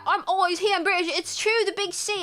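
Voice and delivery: fake British accent. Shown as a demonstration. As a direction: put on an exaggerated British accent. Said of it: "how good is my accent".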